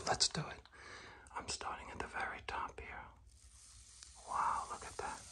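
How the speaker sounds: hushed whisper while working; soft, whispered instructions. Real, not acted. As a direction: talk yourself through the job in a whisper. Whispers along while painting.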